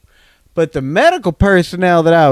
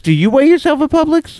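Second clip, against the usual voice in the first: much higher, goofy put-on voice; high silly voice; 11 semitones higher than usual. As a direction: ask it in a squeaky comic voice. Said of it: "repeated in a high put-on voice".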